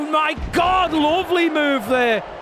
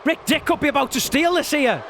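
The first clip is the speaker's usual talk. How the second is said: wrestling commentator; 3 semitones higher than usual. Acted. Live play-by-play.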